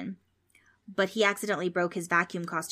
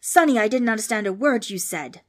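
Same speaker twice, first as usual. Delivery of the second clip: loud, distorted ghost; awful ghost voice; 3 semitones higher, 8 dB louder than usual. A narrator's reading. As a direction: scold loudly as a ghost. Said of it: "was distorted and awful".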